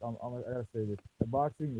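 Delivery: muffled, like through a wall. Real, not acted. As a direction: speak with the mic covered. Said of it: "you sound muffled".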